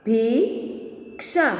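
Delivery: slow, each sound drawn out, rising at the end; slow drawn-out reading. Shown as a demonstration. Teacher slowly sounding out a word for students, drawn out. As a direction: stretch the word slowly for the class. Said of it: "अब इसका शब्द हम देखें".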